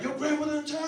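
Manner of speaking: loud, high excited question; eager friends. Retold or imitated.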